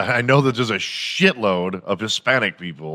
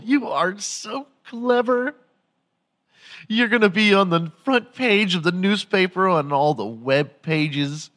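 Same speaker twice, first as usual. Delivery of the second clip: high, slow, cooing self-praise; self-admiring Jill; 9 semitones higher than usual. Acted. Jill Biden praising herself before meeting Mexicans, high slow cooing. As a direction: purr it like you adore your own cleverness.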